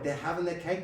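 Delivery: ordinary delivery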